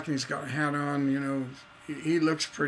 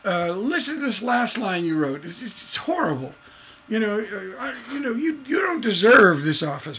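raised, higher-pitched scolding rant; laying into someone; 5 semitones higher, 3 dB louder than usual. Acted. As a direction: tear into them like a harsh critic. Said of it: "just lay into you".

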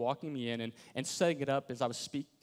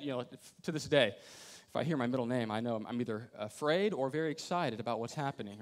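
ordinary delivery

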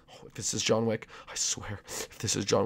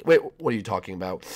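ordinary talk

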